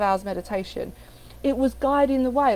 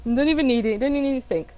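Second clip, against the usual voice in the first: ordinary talk, nothing marked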